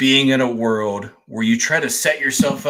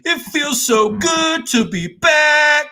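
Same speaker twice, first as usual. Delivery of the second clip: high, slow over-the-top welcome back; milk it like a showman; hammed-up host; 5 dB louder, slower than usual. Hammed show intro.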